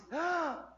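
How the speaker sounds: classic startled gasp; sharp, high gasp of mock fright; scared gasp. Shown as a demonstration. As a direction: gasp as if someone just jumped out at you. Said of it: "what I want you to do is this classic".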